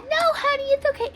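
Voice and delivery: high, loud mock reassurance. Shopping woman dismissing tornado, high imitated voice. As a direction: wave it off in a high put-on voice.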